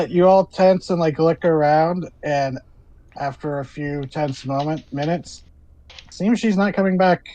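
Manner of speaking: normal talking voice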